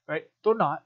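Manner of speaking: normal talking voice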